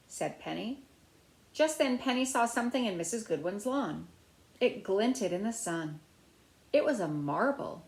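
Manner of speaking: usual speaking voice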